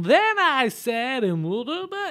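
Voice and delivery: hammy comic voice, high and drawn out; wild overacting. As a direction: ham it up like a bad comic. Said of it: "the over-the-top and the voice goes like".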